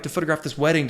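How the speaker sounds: ordinary delivery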